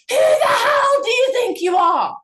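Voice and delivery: high, loud outraged yell; retold angry scream. Mother screaming at her teenager, high angry shout. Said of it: "I was screaming at her".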